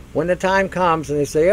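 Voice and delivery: ordinary talk, nothing marked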